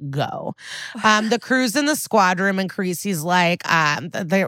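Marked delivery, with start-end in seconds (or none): none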